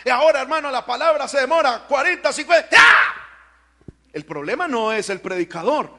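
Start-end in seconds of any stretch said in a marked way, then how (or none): 0.1-3.2 loud, higher, fed-up mock whine; exasperated complaint. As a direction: mimic the impatient churchgoer loudly. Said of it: "decía". (queja exasperada imitada, más alta y fuerte)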